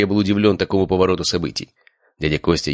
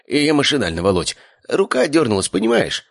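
high, pleading uncle voice; desperate excuse; 10 semitones higher than usual. A narrator's reading. Uncle making excuses to nephew after attack, desperate. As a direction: jump up in pitch, nervously justifying yourself. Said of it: "Посмотрел он на меня отчаявшимся взглядом".

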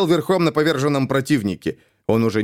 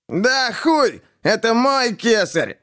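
high, slow indignant outburst; a slow, high cry of offended ownership; indignant protest; 11 semitones higher, much slower than usual. A narrator's reading. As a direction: protest hotly that it's his. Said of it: "возмутился Жарков".

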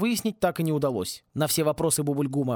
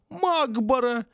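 much higher whiny wail; whining twins. Acted. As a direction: whimper it like scared boys. Twins whine excuses. (хнычущие мальчишки, высоко)

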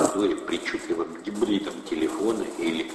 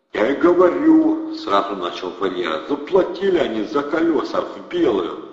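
loud, higher gangster voice; thug explaining; 5 semitones higher, 6 dB louder than usual. A narrator's reading. Thug starts explaining to Atasov, loud and eager. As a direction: hurry to explain.

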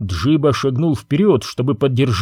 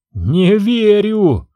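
slow, higher mock wail; wailing outcry; 8 semitones higher, 3 dB louder, much slower than usual. Prince refusing to believe his mother, higher drawn-out wail. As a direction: cry out in disbelief. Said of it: "завопил принц Бэджу".

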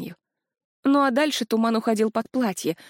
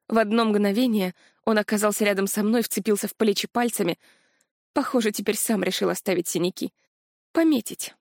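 plain reading voice

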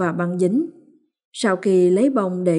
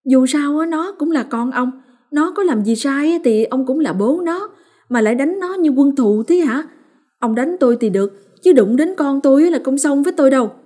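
high, loud furious rant, racing at the end; enraged retort; 7 semitones higher, 4 dB louder, faster than usual. A narrator's reading. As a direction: fire it back, shaking with rage. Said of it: "ba máu sáu cơn đáp lại". (bà Liên cãi lại chồng, giận dữ)

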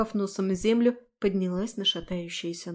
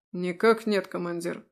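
dejected reply; 3 semitones lower than usual. Acted. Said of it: "понуро отозвался он".

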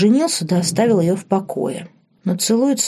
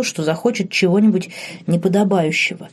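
ordinary delivery, nothing marked

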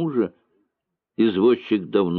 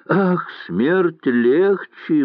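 slow sighing, higher; 7 semitones higher, 3 dB louder, much slower than usual. A narrator's reading. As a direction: breathe it out on a sigh. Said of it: "вздохнула Нина". (со вздохом, медленно)

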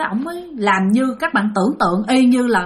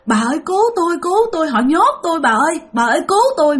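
higher, pleading ghostly cries; pitiful wailing; 6 semitones higher, faster than usual. Retold or imitated. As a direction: moan for help from beyond. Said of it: "kêu thảm thiết vậy".

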